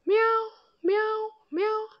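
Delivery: very high mews; kitten mewing. Pretend. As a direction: meow like a little kitten. Kitten sound for children.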